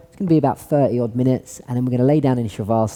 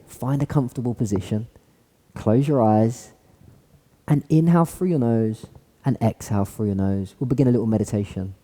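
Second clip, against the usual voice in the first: guided meditation; 2 semitones lower, 3 dB quieter than usual. Acted. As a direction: gently guide them into stillness. Leads a seated meditation.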